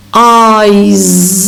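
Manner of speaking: "eyes" stretched, ending in a buzzed z; final voiced z. Shown as a demonstration. Teacher introducing the word, "eyes" with voiced z ending. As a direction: draw out "eyes" into a separate z. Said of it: "final S is pronounced as z".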